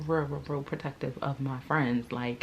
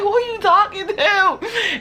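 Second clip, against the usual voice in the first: much higher, loud, laughing yell; confrontational shout; 11 dB louder, slower than usual. Retold or imitated. Confronting the girl.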